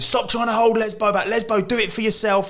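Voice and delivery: high, sing-song call from the touchline; playfully mimicked coach calling out; mock sideline yell. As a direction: chant it like a manager on the side. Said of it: "he wasn't mentioning my name".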